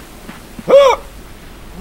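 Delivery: high breathy mock gasp; dramatic gasp.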